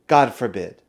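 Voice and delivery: ordinary talk, nothing marked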